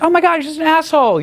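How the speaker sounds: very high-pitched indignant girl imitation; mock outrage. Girl insulted by his drink order, mock high-pitched outrage. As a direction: squeal it as an offended girl, hammed-up.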